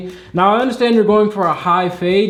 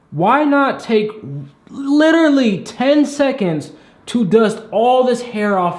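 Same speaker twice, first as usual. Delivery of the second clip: high, slow exasperated question; 6 semitones higher, slower than usual. Real, not acted. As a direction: plead in disbelief, drawn out. Scolding barber about hair left.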